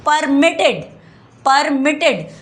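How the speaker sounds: "Permitted" with the middle syllable MIT pushed up; stress on MIT. Shown as a demonstration. Teacher showing why the T doubles, stress on MIT. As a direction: hit "मिट" in "permitted", each time separately. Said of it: "मिट word पे जोर डाला जा रहा है".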